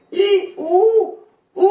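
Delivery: "I, U, U" held long and high; vowel demonstration. Shown as a demonstration. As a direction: stretch each of "I", "U", "U" separately on a high note. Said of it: "ce sont des voyelles faibles".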